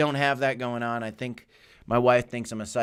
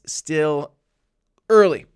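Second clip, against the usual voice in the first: loud emphatic; 7 dB louder, much slower than usual. Real, not acted. Streamer building a point to viewers, loud punch. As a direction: hit the last word hard.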